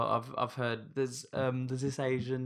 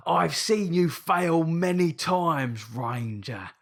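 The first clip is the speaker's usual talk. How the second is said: orc voice; 3 semitones higher, 5 dB louder, much slower than usual. Retold or imitated. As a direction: drag out each word like a brute warrior. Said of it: "shit version of his voice".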